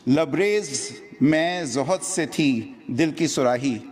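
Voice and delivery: measured Urdu verse recital, pitched above chat; declaimed mushaira-style verse with lilting line endings; poetry recitation. Acted. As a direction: recite each couplet to the audience, pausing between phrases. Recites a humorous Urdu poem.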